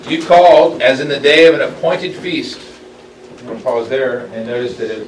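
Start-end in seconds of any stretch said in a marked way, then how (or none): 0.1-2.6 raised, louder solemn reading voice; scripture reading. Acted. Teacher reading Isaiah aloud to the Bible study, solemn. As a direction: read it out as holy text.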